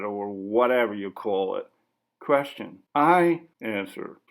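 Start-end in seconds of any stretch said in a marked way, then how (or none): none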